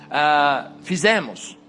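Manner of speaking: ordinary delivery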